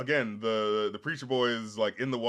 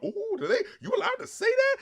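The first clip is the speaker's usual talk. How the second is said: high, startled self-quote; mock shocked. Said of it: "I was like".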